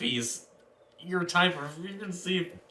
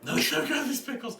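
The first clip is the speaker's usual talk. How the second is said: higher echoed character voice; 10 semitones higher than usual. Retold or imitated. GM echoes the player's line.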